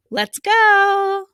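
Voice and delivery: high, loud rallying call; cheery teacher whoop; excited cheer. Real, not acted. Teacher rallying kids to start.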